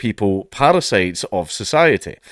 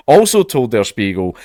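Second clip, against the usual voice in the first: ordinary delivery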